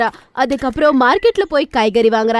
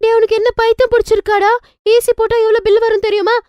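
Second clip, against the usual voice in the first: sharp, higher scolding; scolding mother; 7 semitones higher than usual. Acted. Mother scolds her son for wanting AC, sharp scolding. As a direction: snap at the kid about the bill.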